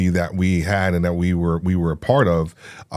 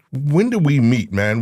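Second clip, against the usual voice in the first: ordinary talk, nothing marked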